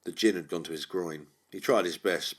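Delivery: usual speaking voice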